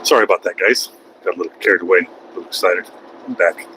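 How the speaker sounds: low, calmed-down apology; subdued apology. Real, not acted. Streamer apologizing to viewers for getting carried away, subdued. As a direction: drop low, regain composure after an outburst. Sheepish apology after shouting.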